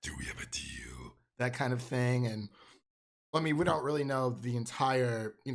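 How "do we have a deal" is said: low, hushed monster whisper; very low, soft, whispered creature voice; Venom. Retold or imitated. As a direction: whisper it deep and sinister, like a creature. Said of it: "when Venom's asking him".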